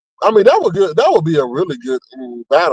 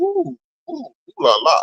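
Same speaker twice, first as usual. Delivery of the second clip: sing-song mock ooh, pitched up then down; 6 semitones higher, 4 dB quieter than usual. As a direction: tease him with a sly ooh la la. Mock reaction to the brag.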